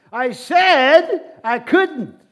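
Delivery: very loud, high and drawn-out insistence; emphatic shout. Retold or imitated. Jesus repeating himself to the confused man, emphatic shout. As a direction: shout it slowly, stretching SAID and COULDN'T.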